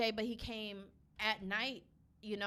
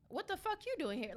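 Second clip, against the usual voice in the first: higher-pitched, softer mock challenge; lightly retold teasing challenge; talking shit; 7 semitones higher, 5 dB quieter than usual. Store clerk to a regular customer, talking shit. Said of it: "I talk shit".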